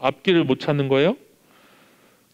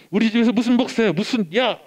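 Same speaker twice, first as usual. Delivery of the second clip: mock yell, pitched higher; mother's retold yell; 7 semitones higher than usual. Mother scolding son about becoming a pastor, mock yell. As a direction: replay the mother's outburst lightly. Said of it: "그러면서 소리를 쳤어요".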